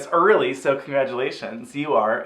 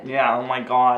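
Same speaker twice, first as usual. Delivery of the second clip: put-on Long Island accent; 4 semitones lower than usual. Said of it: "Nasal, Long Island".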